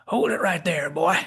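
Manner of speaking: put-on crusty Southern old man; Southern McCoy. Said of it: "picked up some kind of Southern accent".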